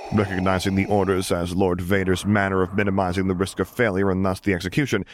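ordinary delivery

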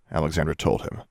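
ordinary delivery, nothing marked

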